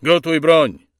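loud, high shouted order; corporal's forceful shout; military command. Acted. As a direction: order the firing squad to ready arms. Corporal commands firing squad.

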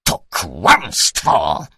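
slow, heavy outraged cry; furious shout. A narrator's reading. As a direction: hurl the denial back. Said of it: "krzyknął". (oburzony okrzyk)

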